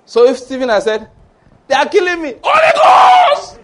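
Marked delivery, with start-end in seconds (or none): 1.7-3.6 high cry exploding into a long loud scream; hammed-up battle cry, stretched and very high; mock desperate prayer. Preacher acts Stephen calling on the Holy Ghost, mock screaming. Imagines Stephen praying vengefully.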